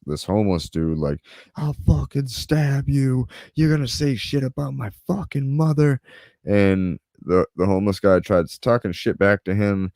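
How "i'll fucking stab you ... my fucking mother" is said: put-on kid yelling threat. Teen threatening the homeless man, higher mock yell. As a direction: imitate a furious kid snarling a threat. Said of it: "I hear these kids yelling".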